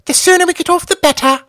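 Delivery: high camp pilot voice, far from a murmur; camp Algy voice. A narrator's reading. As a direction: gush it out in a high, swooning falsetto. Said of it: "murmured Algy".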